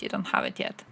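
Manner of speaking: hushed breathy whisper of the repeat. Shown as a demonstration. Whispering the line again to chat. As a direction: whisper it like a secret aside.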